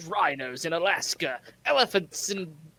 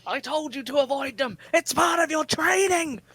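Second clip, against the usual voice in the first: high-pitched, hammed-up fatherly telling-off; comic drill-instructor dad, pitched up; mock scolding shout; 6 semitones higher than usual. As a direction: yell it across to the son, stretching the last phrase. Acted father scolding trapped son.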